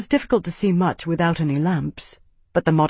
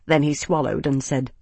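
usual speaking voice

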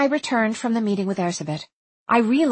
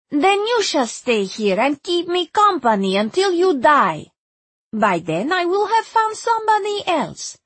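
Hungarian woman companion-seeker; 2 semitones higher than usual. Acted. Erzsébet tells the stranded visitor she will need companions, insistent accented voice. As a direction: lift the voice into Erzsébet, insistent and measured.

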